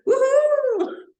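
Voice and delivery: high, loud cheering whoop; mock whoop of glee. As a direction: cheer like gossips rising from the sea. Children of Iemanjá cheering. (grito de alegria debochado)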